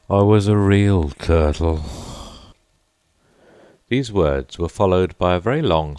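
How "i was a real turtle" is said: deep sigh. A narrator's reading. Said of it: "with a deep sigh".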